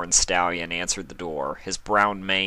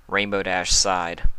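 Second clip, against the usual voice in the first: ordinary delivery, nothing marked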